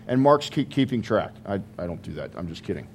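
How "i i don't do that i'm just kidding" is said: quiet aside. Real, not acted. Joking aside to congregation.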